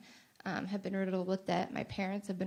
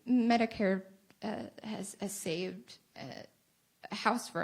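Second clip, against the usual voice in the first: crying; 4 dB louder than usual. Real, not acted. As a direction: voice cracking into a sob. Cry heard on the word.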